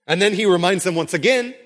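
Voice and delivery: usual speaking voice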